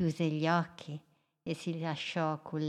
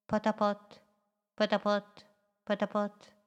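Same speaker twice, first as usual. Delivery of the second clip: chug it out like a toy boat engine; little boat engine sound, evenly repeated; little boat putt-putt; 4 semitones higher than usual. A narrator's reading. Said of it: "Lei faceva solo".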